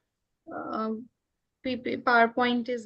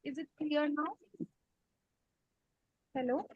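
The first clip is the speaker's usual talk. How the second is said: faint, distant voice; almost lost on the line; barely audible; 2 semitones higher, 12 dB quieter than usual. Real, not acted. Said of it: "your voice is very low".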